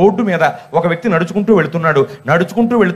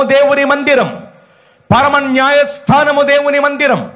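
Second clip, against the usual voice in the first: preaching; 6 semitones lower than usual. Acted. Pastor urging the congregation to come to church, preaching. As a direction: build to a fervent call to church.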